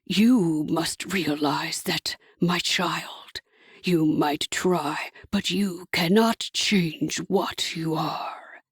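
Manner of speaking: low, slow and whispered, almost tender; a false fatherly hush from a devil. A narrator's reading. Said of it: "almost tenderly".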